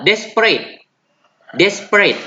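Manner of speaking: "desperate" tinggi dan jelas /despret/; model word. Shown as a demonstration. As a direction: contohkan "desperate" untuk ditiru. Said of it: "ulangi seperti yang saya ucapkan". ("desperate" diucapkan jelas)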